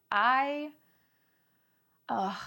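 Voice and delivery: ordinary talk, nothing marked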